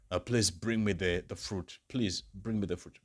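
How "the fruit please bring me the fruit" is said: usual speaking voice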